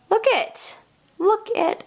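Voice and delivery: high, delighted sing-song "Look it"; excited look it. Real, not acted. Crafter showing viewers her finished butterfly, high and excited. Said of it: "I know that's not a word".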